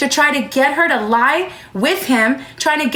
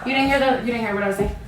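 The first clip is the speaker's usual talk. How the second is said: gentle with daughter; 4 semitones lower, 6 dB quieter, much faster than usual. Real, not acted. Mother questions young daughter.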